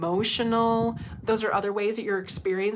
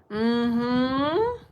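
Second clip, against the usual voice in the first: ordinary talk, nothing marked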